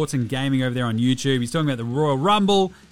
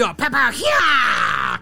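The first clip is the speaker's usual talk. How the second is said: higher, louder, slow hammed-up karate shouts; mock karate cries; 8 semitones higher, 5 dB louder, much slower than usual. Delhi imitating the show's karate fights, hammed-up yells. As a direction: throw out cartoon martial arts yells.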